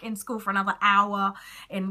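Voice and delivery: normal talking voice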